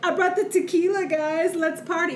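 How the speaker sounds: high, loud party-guy mimicry; Spencer. Mocking a castmate drinking at the club, high hyped-up party voice. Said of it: "Spencer's like".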